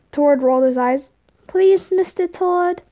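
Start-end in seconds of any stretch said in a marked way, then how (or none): 1.5-2.8 put-on high, slow childish plea; drawn-out, pitched-up begging; pleading kid. A narrator's reading. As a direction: beg in a little boy's voice. Tom begging Tord.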